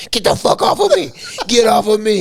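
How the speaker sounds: high-pitched mock shriek; transformed, frantic roommate. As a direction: imitate a pinned man yelling to be freed. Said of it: "He done transformed".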